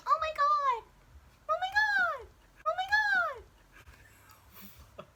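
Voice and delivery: very high-pitched squealed gasps of scandal; mock shrieking crowd. Everybody reacting to the rumor, mock shrieking. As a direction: squeal it like a shocked gossip. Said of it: "So everybody's like".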